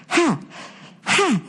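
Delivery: high, sharp hot-mouth gasps; burned-mouth panting. Retold or imitated. Friend bit hot cheese, high gasping pants. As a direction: imitate a friend huffing at scalding cheese. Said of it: "and he just goes".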